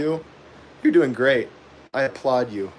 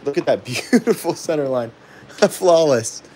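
laughing self-praise; 2 semitones higher than usual. Real, not acted. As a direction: laugh through mock-grand praise. Laughs while praising own center line.

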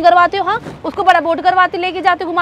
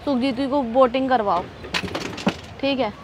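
low, softened, resigned rejection; hurt, sullen; 7 semitones lower, 7 dB quieter than usual. Acted. Girlfriend telling boyfriend to go to his rival, hurt and sullen. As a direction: withdraw from the fight, quietly wounded.

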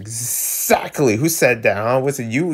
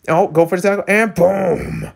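ordinary talk, nothing marked

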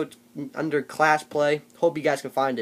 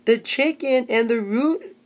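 slow, halting, higher and louder read-aloud with squeaky peaks; mocking Tony's reading; 6 semitones higher, 8 dB louder, much slower than usual. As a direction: ham up a clueless kid sounding out a story. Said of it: "this was Tony".

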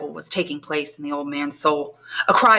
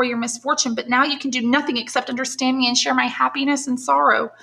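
put-on higher, anxious questioning girl; young woman pleading, raised pitch; young granddaughter; 6 semitones higher than usual. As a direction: coax answers from a mute grandfather. Valentine confides in grandfather.

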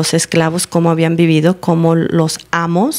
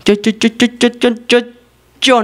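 higher, stammering yo yo yo; stuttering Moses; 5 semitones higher than usual. Retold or imitated. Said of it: "el Moisés era tartamudo".

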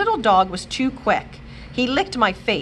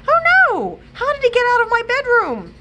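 high, loud and fast, in sudden alarm; alarmed cry; 6 dB louder than usual. A narrator's reading. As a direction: panic as the dog charges in. Dog bursts in, alarm.

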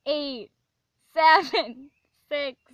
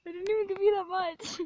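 laughing; 6 semitones higher, 7 dB louder than usual. Real, not acted. Laughing at him.

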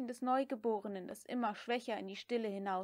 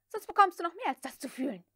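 fast, high, loud threat; angry shout; 7 semitones higher, 6 dB louder, faster than usual. A narrator's reading. Scarlett yells a quick threat at Prissy, loud and high. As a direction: threaten her in a rushed yell. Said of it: "schrie sie".

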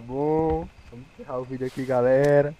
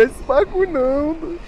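high, loud panicked cry; panicking gamer; 6 dB louder than usual. Real, not acted. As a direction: freak out as it goes dark. Panicking in dark game.

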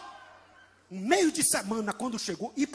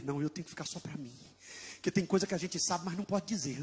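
quiet inner musing; 7 semitones lower, 7 dB quieter than usual. Acted.

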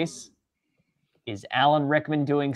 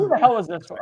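playful demand; 7 semitones higher, much faster than usual. Real, not acted. Teasing demand.

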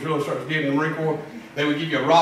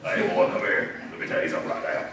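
low, croaking drill instructor bark, much lower and softer; a recruit imitating the drill instructor's low frog croak; 11 semitones lower, 5 dB quieter than usual. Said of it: "You had to actually talk like a frog".

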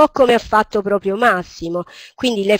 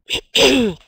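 short real cough; quick cough between phrases; 5 semitones higher, 4 dB louder than usual. Real, not acted. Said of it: "sono un po' malata".

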